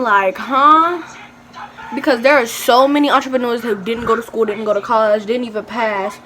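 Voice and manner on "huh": high, loud incredulous huh; mock disbelief, pitched right up; baffled. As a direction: react as if that made no sense. Retelling baffled reaction to teacher.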